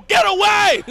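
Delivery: high urgent cheer; mock rooting yell. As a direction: yell him away from danger. Rooting for fleeing driver.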